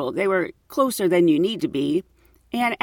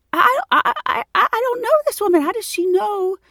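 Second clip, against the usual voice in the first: high, stammering, rushed panic; flustered panic; 11 semitones higher than usual. Retold or imitated. Baffled by a stranger describing her condo, high flustered stammer. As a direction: stammer it out high and fast, rattled. Retold panicked inner thought.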